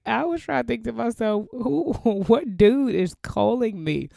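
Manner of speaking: high, loud, laughing bewilderment; baffled husband. Retold or imitated. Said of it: "he was like".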